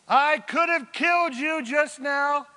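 high, loud long-distance holler; shouting from afar. Acted. David calls to Saul out of bow shot, shouting. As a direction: project every word across a valley. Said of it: "from some distance away".

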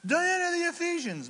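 high-pitched crowd chant; hammed-up high yell; mock crowd yelling. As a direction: imitate a screaming, chanting mob. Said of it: "knuckleheads screaming and yelling for two hours".

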